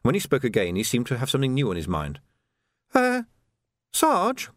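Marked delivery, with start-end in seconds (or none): 2.9-4.5 put-on much higher, boyish recruit; an eager young constable; youthful lad's voice; young Sam. A narrator's reading.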